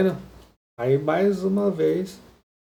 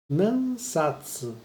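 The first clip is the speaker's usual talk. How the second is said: slow model word; 2 semitones higher, much slower than usual. Shown as a demonstration. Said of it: "Hon é livro".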